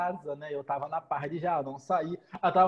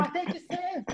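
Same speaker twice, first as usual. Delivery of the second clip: high-pitched, softer mock whine; insistent protest; 11 semitones higher, 6 dB quieter, slower than usual. Retelling the argument over open bar, insistent high protest. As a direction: insist in a squeaky put-on protest.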